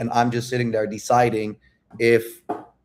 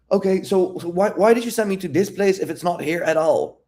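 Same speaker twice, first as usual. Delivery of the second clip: higher-pitched mock complaint after a sigh; imitating veiled annoyance; 8 semitones higher than usual. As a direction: act out her miffed questioning. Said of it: "I can tell that she's pissed".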